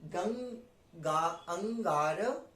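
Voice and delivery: ordinary talk, nothing marked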